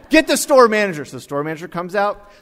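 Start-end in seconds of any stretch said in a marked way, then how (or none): 0.1-1.0 loud, high angry customer order; puffed-up anger. Retold or imitated. Puffed-up customer ordering staff around, angrily. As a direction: order them about in a raised, outraged voice. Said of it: "he started to get all puffy".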